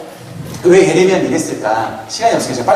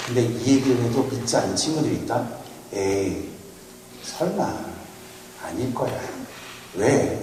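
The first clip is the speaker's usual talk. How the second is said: ordinary talk, nothing marked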